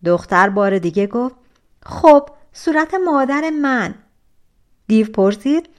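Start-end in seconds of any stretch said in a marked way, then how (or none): 1.9-3.9 cocky. Acted. Rude stepsister answers the giant.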